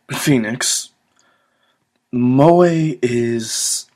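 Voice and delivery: ordinary delivery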